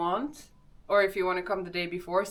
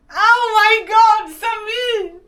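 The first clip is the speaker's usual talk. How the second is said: very high, loud, drawn-out greeting; excited shout; 14 semitones higher, 15 dB louder, slower than usual. Real, not acted. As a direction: shriek his name with delight.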